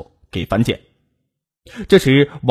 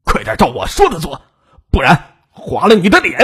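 nervous threat, building higher and louder; tense angry scolding; 4 semitones higher, 4 dB louder than usual. A narrator's reading. Robber with scissors threatening a hesitant captive, tensely angry. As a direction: snap an angry order under pressure. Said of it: "紧张的怒斥道".

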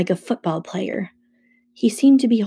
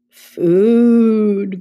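long, loud, slightly raised moan; louder moaning; 2 semitones higher, 7 dB louder than usual. A narrator's reading. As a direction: moan the word out more insistently. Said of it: "Grover moaned a little louder".

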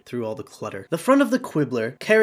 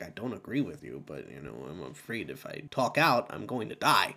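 fearful citizen; 7 semitones lower, 7 dB quieter than usual. Retold or imitated. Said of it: "other people who are like".

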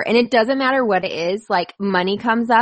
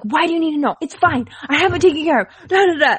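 imitating her own defensive reply, pitched higher; mock defensive; 6 semitones higher, faster than usual. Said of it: "all up in arms".

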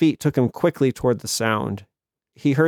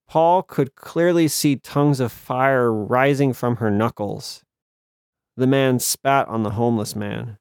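ordinary delivery, nothing marked

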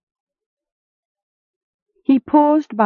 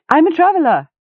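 much higher, a quick yelp; hasty yelp; 11 semitones higher, 4 dB louder, faster than usual. A narrator's reading. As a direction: yip it out fast, startled. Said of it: "he yupped hastily".

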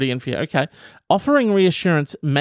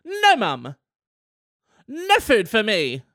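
high put-on voice saying no to food; mock refusal; 5 semitones higher, slower than usual. Practising to mum, turning down food in a high voice.

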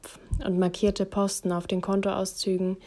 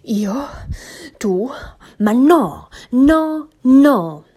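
much louder, higher, outraged denials; indignant outburst; 6 semitones higher, 9 dB louder, much slower than usual. A narrator's reading. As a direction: explode with wounded indignation. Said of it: "presste er entrüstet heraus".

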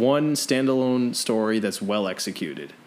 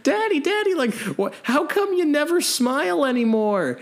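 put-on high, pleading little kid; child's voice; 13 semitones higher than usual. Imagined kid asking his haunted dad, high and pleading. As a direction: squeak it up like a small child.

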